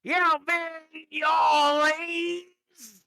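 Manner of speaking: high, loud, drawn-out hillbilly holler; mock Southern yell. Louisiana guy greeting a French person, in a high drawn-out yell. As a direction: bellow it like a Louisiana good ol' boy. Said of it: "tried to go up to an actual French person and be like".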